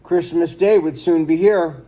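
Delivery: plain reading voice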